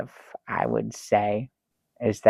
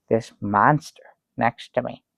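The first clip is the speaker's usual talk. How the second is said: usual speaking voice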